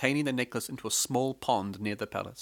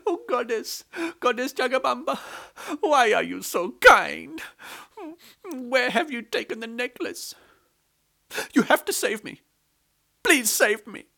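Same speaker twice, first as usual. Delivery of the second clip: high, louder wailing plea; crying plea; 12 semitones higher, 4 dB louder than usual. A narrator's reading. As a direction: cry out to the goddess for rescue. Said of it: "heard somebody crying".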